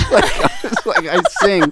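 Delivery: high, loud protest through laughter; laughing protest. Retold or imitated. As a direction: laugh while pleading your case. Joking about being no magician.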